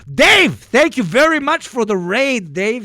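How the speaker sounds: loud, high delighted shout-out; excited thanks. Real, not acted. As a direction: burst out greeting a surprise guest. Surprised greeting of raiding streamer.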